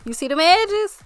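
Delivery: high, loud bragging; excited. Real, not acted. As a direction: show off the edges proudly. Showing off finished edges.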